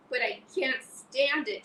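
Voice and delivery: slow desperate shout. Acted. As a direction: Wilbur shouts he won't leave her. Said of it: "shouted Wilbur".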